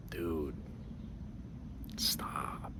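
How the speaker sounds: very soft, low near-whisper; hushed intervention. Retold or imitated. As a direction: quietly urge a friend to back off. Buddy quietly tries to intervene.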